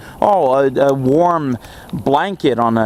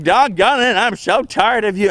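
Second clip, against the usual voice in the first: a mild mock grumble, a little higher in pitch; funny fed-up dad voice; 4 semitones higher than usual. Father fed up with mother and son quarrelling, lightly imitated. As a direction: sigh it out like a worn-out father. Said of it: "it's a funny voice".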